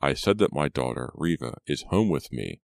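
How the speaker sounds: usual speaking voice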